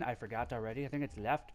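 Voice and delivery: ordinary delivery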